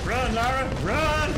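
high, loud, urgent shout; urgent yell. Real, not acted. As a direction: yell for her to run. Urging game character to flee.

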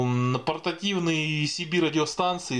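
normal talking voice

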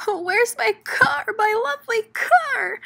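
crying out. Acted. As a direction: lament the lost car. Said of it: "She blew her nose and cried".

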